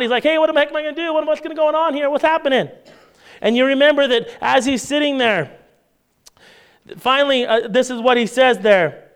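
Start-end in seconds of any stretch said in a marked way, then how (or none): 0.2-2.7 higher-pitched mock panic; freaking out. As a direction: play a servant starting to freak out. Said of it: "the servant's freaking out".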